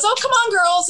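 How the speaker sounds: high breezy invitation; inviting call. Retold or imitated. Manager inviting the fans to his room, high and breezy. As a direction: wave the girls along cheerfully. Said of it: "and Gary goes".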